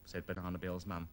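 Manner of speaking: normal talking voice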